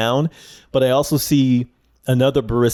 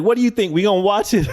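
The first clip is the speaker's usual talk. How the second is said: higher, playful barista voice; mock barista; 9 semitones higher, faster than usual. Barista turning to the other girl, higher mock voice. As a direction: ham up the barista asking her coworker. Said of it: "She's got this nice Southern accent".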